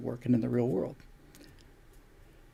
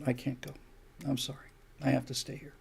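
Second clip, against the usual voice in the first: quiet farewell; 11 dB quieter than usual. Acted. Husband's farewell in acted scene.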